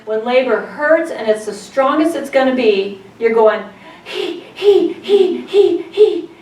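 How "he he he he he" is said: quick high "hee" puffs through the teeth; high, rapid hyperventilating hees; panicky "hee" breathing. Shown as a demonstration. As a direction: demonstrate fast hee-hee labor breathing. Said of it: "having you say the word he and breathe through your teeth".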